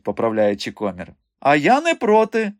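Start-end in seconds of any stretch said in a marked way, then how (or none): 1.4-2.5 high, loud, eager shout; sudden cry. A narrator's reading. Milka volunteering before the giggling girls, sudden cry. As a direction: pipe up suddenly at a high pitch. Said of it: "раптом крикнула Мілка".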